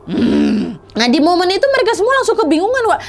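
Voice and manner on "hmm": very low growling hum; guttural low groan; spirit's growl. Retold or imitated. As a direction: rumble a deep "hmm" like a possessing spirit. Voicing the possessing spirit.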